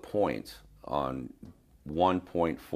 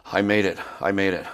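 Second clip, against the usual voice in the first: higher, louder first burst, then easing; huffing and puffing; 4 semitones higher, 6 dB louder than usual. Retold or imitated. Winded client announces he reached the gym, huffing and puffing. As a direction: imitate a winded, triumphant arrival. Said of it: "he was huffing and puffing and panting".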